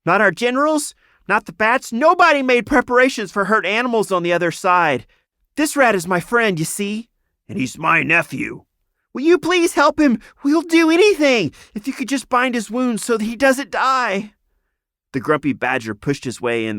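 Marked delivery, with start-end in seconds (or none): 0.0-7.1 high, loud urgent squeaky voice; pleading rat. A narrator's reading. Enemy rat explaining to the healer, anxious pleading. 7.5-8.7 second rat. A narrator's reading. 9.1-14.3 very high, loud desperate squeak; begging rat. A narrator's reading. Frightened rat begging the healer, desperate.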